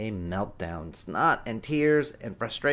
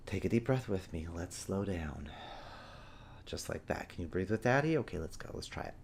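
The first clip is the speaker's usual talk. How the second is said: put-on much softer and lower, soothing a child; calming voice; 5 semitones lower, 10 dB quieter than usual. Shown as a demonstration. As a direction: ease them down with a gentle voice. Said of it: "as I start to slow down".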